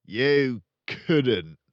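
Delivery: loud, curt put-down; dismissive. Acted. Father dismissing Matilda, loud put-down. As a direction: brush her off with scorn. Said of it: "the father said".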